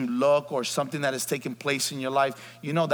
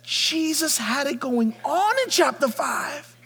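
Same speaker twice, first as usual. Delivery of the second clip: full-force preaching; 13 semitones higher, 6 dB louder than usual. Acted. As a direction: preach it like a roll call of miracles.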